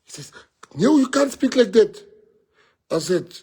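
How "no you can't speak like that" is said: much higher and louder, indignant scolding; mimicking interviewer. Imitating the interviewer's high, loud objection to his language. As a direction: squeak out the reporter's shocked objection.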